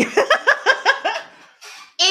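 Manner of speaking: loud high burst of laughter. Real, not acted. Amused at her passport note, loud high laugh. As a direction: cackle in disbelief.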